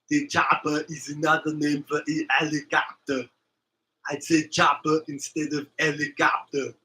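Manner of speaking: put-on mild thick Austrian accent barking orders; Arnold Schwarzenegger. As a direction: Austrian action hero, lightly done. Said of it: "he still has that real thick accent".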